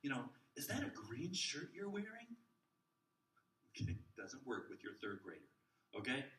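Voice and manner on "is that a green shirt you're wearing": put-on higher, playful kid-talk; a light sing-song for a small child; silly question to a child. Shown as a demonstration. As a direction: ask it like to a preschooler. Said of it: "saying things like".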